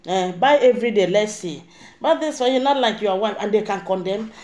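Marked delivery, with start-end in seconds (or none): 2.0-2.6 mock sweet voice. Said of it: "dey call sweet name".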